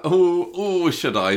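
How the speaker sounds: hammed-up torn hesitation; pained. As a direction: agonise playfully over giving in. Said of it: "Just the pained expression".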